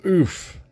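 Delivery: high pained oof; high grunt. Retold or imitated. Spy dumped on the floor, high grunt. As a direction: grunt as if dropped. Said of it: "he goes".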